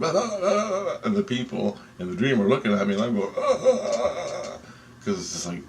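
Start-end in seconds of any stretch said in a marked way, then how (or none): 0.0-1.0 high, juddering "ah"; long quavering wail way up high; shaking voice. Retold or imitated. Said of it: "My voice is going". 3.4-4.6 high, wobbling "ah"; quavering high wail; shaking voice. Retold or imitated. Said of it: "My voice is going".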